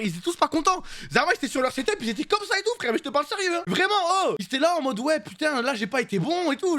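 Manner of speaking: haut perché, voix de récit incrédule; incrédulité amusée. Retold or imitated. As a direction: raconte leur mécontentement d'une voix très aiguë. Raconte, incrédule, leurs mines déconfites. (récit incrédule, voix haut perchée)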